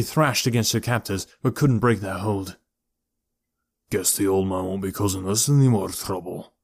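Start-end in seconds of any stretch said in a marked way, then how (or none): 3.9-6.5 throaty Scottish thug, darkly amused; throaty Scottish chuckle. A narrator's reading. Pinky gloats over the old man, throaty Scottish voice. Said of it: "Pinky chuckled".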